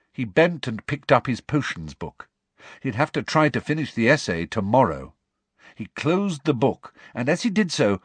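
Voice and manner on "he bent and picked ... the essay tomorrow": ordinary delivery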